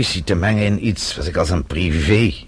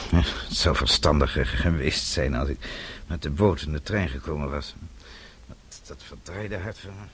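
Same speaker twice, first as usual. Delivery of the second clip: fading, tired; 3 semitones lower, 10 dB quieter than usual. Acted. Old man tells Roger he'll rest, fading quietly. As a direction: voice sinking lower and softer, weary.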